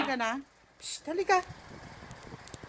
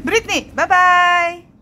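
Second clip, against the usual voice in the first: high, loud call ending long; loud singsong pretend leaving; mock goodbye call; 5 semitones higher, 6 dB louder, much slower than usual. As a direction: call out a teasing, drawn-out farewell. Calling while threatening to leave.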